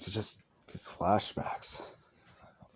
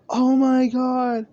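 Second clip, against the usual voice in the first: high, loud, drawn-out mock wail; hammed-up happy weeping; tearful, moved; 9 dB louder, slower than usual. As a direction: cry it out slowly, overcome with emotion. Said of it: "tearing up".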